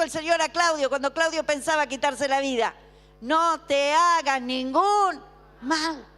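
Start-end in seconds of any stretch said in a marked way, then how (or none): 3.2-6.1 slow, emphatic proclamation, fading on the last word; crying out. Retold or imitated. Preacher giving the Lord's words to Claudio, slow crying out. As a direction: draw out each word firmly. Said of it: "clamó a gran voz, gritó".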